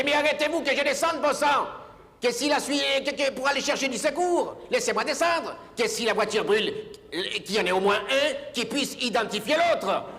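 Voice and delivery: high, agitated outburst; worked-up ranting. Retold or imitated. Passenger ranting at the driver to let him out, high and agitated. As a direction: get worked up on his behalf. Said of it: "je me suis énervé à sa place".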